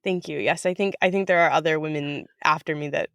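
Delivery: usual speaking voice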